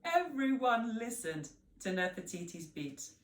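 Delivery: plain reading voice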